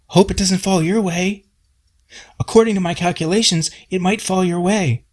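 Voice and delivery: high, prissy know-it-all voice; Robert calling from the window in a mock high voice; smug Robert. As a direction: chirp it like a smug busybody.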